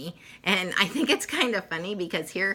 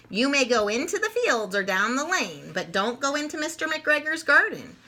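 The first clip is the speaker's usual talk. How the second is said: higher, lightly voiced mother bunny; a gentle hint of a fussing mother; mother rabbit; 8 semitones higher than usual. Mother rabbit addressing her little ones, lightly voiced.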